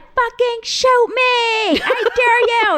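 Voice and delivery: high, loud, very drawn-out challenge; wild re-enacted yell at full pitch; yelled dare. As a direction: scream it slowly, daring them to act. Acted dare in retold armed standoff.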